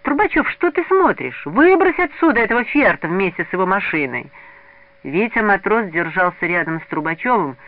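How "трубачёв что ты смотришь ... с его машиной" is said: high-pitched, loud indignant urging; heated demand, raised voice; angry outburst. A narrator's reading. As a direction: urge a friend on furiously. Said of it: "вдруг гневно вырвался из толпы Мазин".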